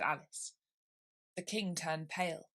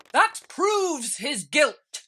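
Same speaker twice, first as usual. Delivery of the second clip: loud, slow bossy queen; 9 semitones higher, 12 dB louder, much slower than usual. Acted. Queen overruling Alice, loud and slow. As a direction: bellow it slowly like a bossy queen.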